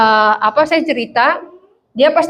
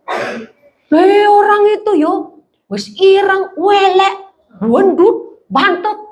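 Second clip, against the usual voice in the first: higher-pitched, drawn-out jeering; mocking insults; 5 semitones higher, much slower than usual. Gossip judging another person's looks, mocking. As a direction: pick someone apart in mock disgust.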